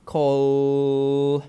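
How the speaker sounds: call, the final L held long. Shown as a demonstration. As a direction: stretch the L in call. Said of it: "make the L longer at the end".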